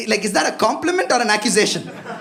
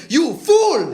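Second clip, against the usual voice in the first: heckler's yell; 4 semitones higher, 4 dB louder than usual. Retold or imitated. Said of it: "he literally stood up and he was like".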